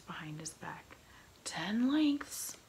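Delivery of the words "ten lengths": high, loud call from the mast; lookout call. A narrator's reading. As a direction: shout the range down. Lookout calls distance.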